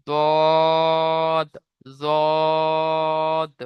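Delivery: দদ with rounded lips, drawn very long; rounded long dad. Shown as a demonstration. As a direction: round the mouth and stretch দদ. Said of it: "মুখ গোল হয়ে যাবে". (মুখ গোল করে লম্বা টেনে দদ)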